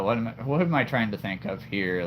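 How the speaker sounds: ordinary delivery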